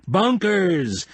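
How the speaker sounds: high, pleased call; satisfied announcement. Acted. Croquet player crowing over his shot, high and pleased. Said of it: "he announced with satisfaction".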